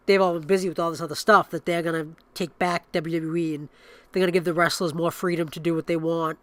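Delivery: usual speaking voice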